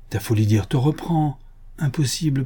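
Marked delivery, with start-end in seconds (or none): none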